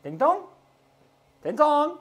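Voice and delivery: high sung ding-dong; doorbell chime. Pretend.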